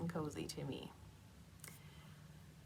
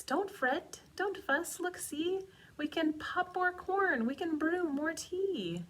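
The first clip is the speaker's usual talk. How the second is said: much higher little mouse voice; squeaky mouse; 12 semitones higher, 5 dB louder than usual. Acted. Mouse squeaking comfort to the sad bear. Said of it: "Mouse squeaks".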